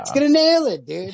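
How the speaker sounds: high, loud mock bro voice; mock tech bro. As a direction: sneer it like a cocky tech dude, high and loud.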